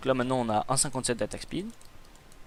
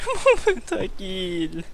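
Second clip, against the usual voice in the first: drawn-out lament; 4 semitones higher, much slower than usual. Real, not acted. Pentakill just missed.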